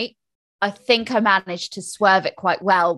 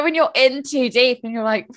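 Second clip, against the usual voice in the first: ordinary talk, nothing marked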